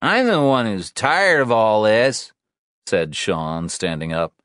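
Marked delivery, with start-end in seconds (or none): 0.0-2.3 raised, indignant comeback; angry retort. Acted. Sean argues back at Anna, indignant. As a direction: throw it back louder and higher.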